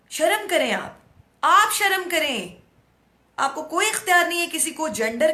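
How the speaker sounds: loud, high, angry rebuke; stern scolding. Real, not acted. Scolds those who taunt age. (तीखी डांट, ऊँची आवाज़ में)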